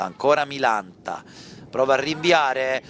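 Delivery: ordinary talk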